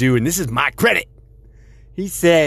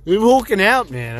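mock anger; 9 semitones higher than usual. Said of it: "I'm getting angry".

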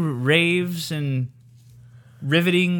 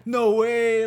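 very high, put-on Italian-American exclaiming; 7 semitones higher than usual. Retelling a TikTok of Italians meeting, high hammed-up Italian-American voice. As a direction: ham up Italians discovering a shared haunt. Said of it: "Italians running into each other".

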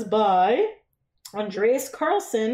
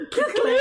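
high-pitched mock clicking of metal; playful imitation of a clinking chain; imitated censer click; 12 semitones higher than usual. Imitating censer as priest swings it.

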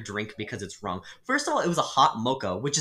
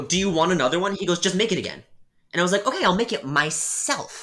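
loud, higher, fed-up back-and-forth; exasperated; 7 semitones higher, 7 dB louder than usual. Retold or imitated. Barista and rude customer arguing over remaking a drink, loud and fed up. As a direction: play both sides, fed up.

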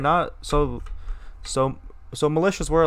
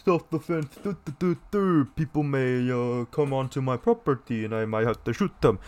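mocking gun owner. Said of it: "people are like".

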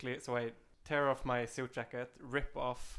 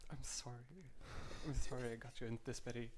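soft, hushed apology; very quiet, confiding undertone; whispering; 9 dB quieter, faster than usual. Acted. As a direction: murmur a private apology to a friend. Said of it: "I'm just whispering to Betty".

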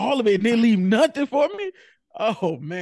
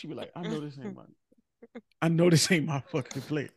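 hushed, stunned muttering to yourself; low and quiet, can't believe it; quiet disbelief; 6 semitones lower, 5 dB quieter than usual. Pretend. Staring at the empty plate, quiet disbelief. Pretend finding food eaten.

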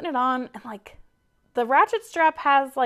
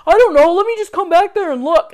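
loud, higher, exasperated; mock impatient husband; 7 semitones higher, 10 dB louder than usual. Imitating husband impatient at trailer, loud. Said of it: "He's like".

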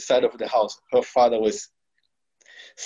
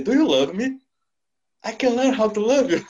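incredulous; 9 semitones higher, 3 dB louder, faster than usual. Retold or imitated. Incredulous answer to proposal.

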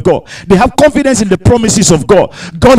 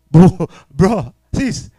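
normal talking voice

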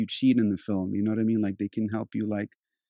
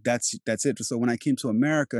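normal talking voice

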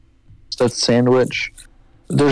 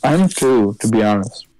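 talking through a mouthful of seeds; chewing while talking; 3 semitones lower, faster than usual. Real, not acted. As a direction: speak while munching sunflower seeds. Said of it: "Can you guys hear me eating sunflower seeds?".

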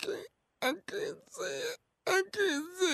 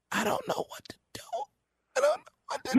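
tearful, rising panic; panicky crying; 10 semitones higher, 3 dB louder, much faster than usual. Acted. Scared caller breaks down to the host, crying. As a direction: voice cracking with fear.

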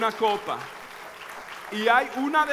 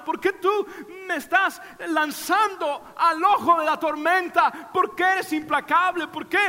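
put-on child's pleading; 8 semitones higher than usual. Preacher voicing his crying son to his father, higher pleading. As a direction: beg papi why, as the hurting child. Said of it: "él quería decirme".

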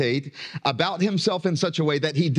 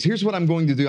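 ordinary talk, nothing marked

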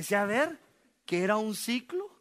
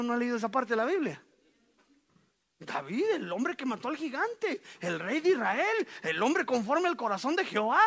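ordinary delivery

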